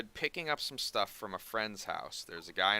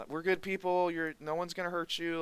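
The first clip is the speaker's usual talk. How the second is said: reassuring; 8 semitones higher than usual. Retold or imitated. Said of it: "I'm just saying stuff that come".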